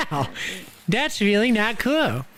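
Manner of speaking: normal talking voice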